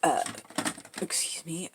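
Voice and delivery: ordinary talk, nothing marked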